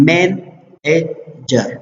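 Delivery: مینیجر very slowly, as men, ej, jer with pauses; syllable by syllable. Shown as a demonstration. Teacher giving an example of three syllables, مینیجر said syllable by syllable. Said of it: "تھری واول ساوند شوله".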